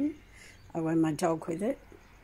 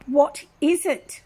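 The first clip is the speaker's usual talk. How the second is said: higher, louder, slow demanding yell; retold yelling nurse; 9 semitones higher, 8 dB louder than usual. Nurse badgering the patient, slow mock yell. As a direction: hammer the question like a pushy nurse. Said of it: "she kept yelling at me".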